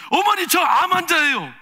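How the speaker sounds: loud, high cry of protest; confronting protest. Retold or imitated. Daughter-in-law confronting her mother-in-law about her cancer, loud protest. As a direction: throw it at her, hurt and angry. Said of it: "이렇게 따졌어요".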